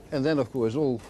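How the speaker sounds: ordinary talk, nothing marked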